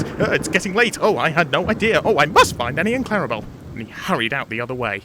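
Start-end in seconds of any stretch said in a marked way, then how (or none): 0.0-3.4 high, louder, babbled excuses; gabble, desperate to get away; flustered panic. Acted. Thomas fleeing the ghost, flustered.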